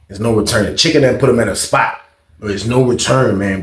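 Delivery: usual speaking voice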